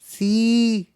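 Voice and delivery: imitating a girl's Spanish accent; mock Spanish-accented little girl; Laura. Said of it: "She had a fat ass accent".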